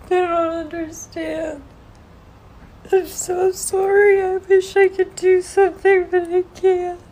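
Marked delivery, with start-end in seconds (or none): none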